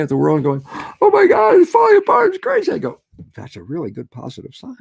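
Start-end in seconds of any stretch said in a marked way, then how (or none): 1.0-2.7 high, loud mock panic; shrill-free hammed-up alarm, much higher and louder; panicked onlooker. Mocking panicked people. 3.3-4.8 calm reply. Retold or imitated. His calm reply to panic.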